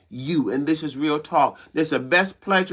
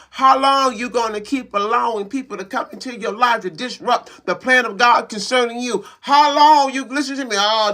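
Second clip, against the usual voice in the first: higher, louder, hammering how long; preaching at full force; 5 semitones higher, 3 dB louder than usual. Acted. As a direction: drive each how long home to the congregation. Keeps preaching, rising again.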